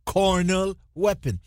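usual speaking voice

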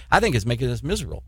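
ordinary delivery

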